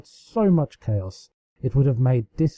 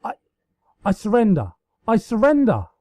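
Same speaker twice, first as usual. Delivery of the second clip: high breathless gasps of surrender, louder on the repeat; choked out between breaths; 8 semitones higher, 3 dB louder than usual. A narrator's reading. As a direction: Luna chokes out surrender while being tickled, breathless. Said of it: "Luna finally managed to choke".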